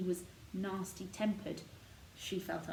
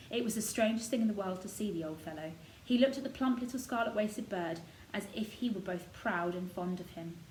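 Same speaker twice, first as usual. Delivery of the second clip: ordinary delivery, nothing marked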